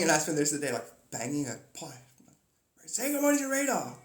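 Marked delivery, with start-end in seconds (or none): 2.8-4.0 high, loud prompting; mock parental insistence; nagging parent. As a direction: order a child to greet someone. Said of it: "like you got a kid".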